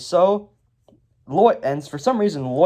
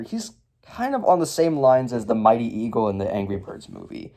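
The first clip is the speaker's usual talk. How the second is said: ordinary talk, nothing marked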